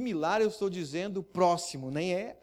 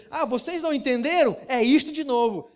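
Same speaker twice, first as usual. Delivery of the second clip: much higher and louder, provoking; defiant repeat; 8 semitones higher, 8 dB louder than usual. Pretend. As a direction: throw it right back at them. Cláudio repeats to the mosque crowd.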